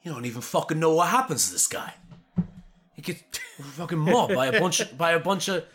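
fast, exasperated mock rant with a laugh; Jason Statham impression. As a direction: rattle it off like an annoyed action star, half laughing. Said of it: "Jason Statham was probably like".

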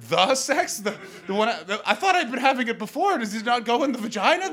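high, loud, stammering astonishment; bewildered disbelief. Acted. Comic replies to woman offering the sex, high loud disbelief. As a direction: blurt it out, utterly thrown. His bewildered reaction in the story.

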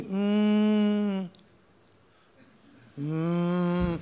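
smooth, steady, held mm engine hum; a single long, even engine drone; right engine hum. Retold or imitated. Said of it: "When actually it should be".

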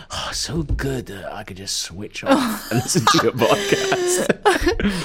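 slow, mock-gushing fan voice; sarcastic. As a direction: gush over it with irony. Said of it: "it's just like".

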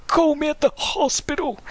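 put-on high, calling old man; strained old man shout. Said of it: "And he's calling out".

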